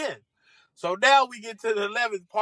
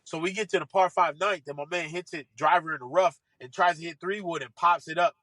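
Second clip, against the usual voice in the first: usual speaking voice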